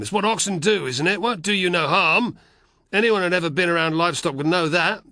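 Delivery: a scornful country ox driver scolding; gruff carter. Acted. Held carter voice.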